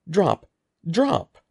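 loud barked commands; shouting. A narrator's reading. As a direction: bark it at the hamster like a dog. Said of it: "Mister Murphy was shouting".

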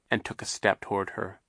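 usual speaking voice

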